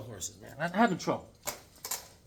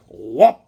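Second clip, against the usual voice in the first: loud whoop; 3 semitones lower, 13 dB louder than usual. Retold or imitated. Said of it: "she's like".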